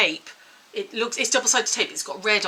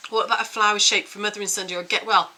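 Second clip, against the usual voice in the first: ordinary delivery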